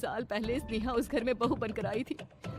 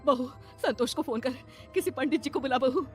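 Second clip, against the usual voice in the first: higher, loud urgent orders; panicked mother-in-law; 6 semitones higher, 7 dB louder than usual. Acted. Mamta telling bahu to fetch a priest, panicked. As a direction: shout frantically for help.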